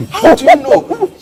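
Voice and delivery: high, slow disbelieving question; incredulous. Retold or imitated. Daughter to mom, high amazed disbelief. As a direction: ask in shock how she could tell. Disbelief at mom's lie detection.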